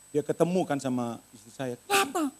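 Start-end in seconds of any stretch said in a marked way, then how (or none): 1.8-2.3 high-pitched urgent question; alarmed question. Retold or imitated. Wife arriving home to the maid, alarmed. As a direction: imitate a worried wife asking.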